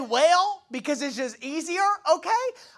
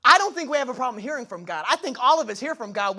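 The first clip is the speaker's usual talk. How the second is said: ordinary talk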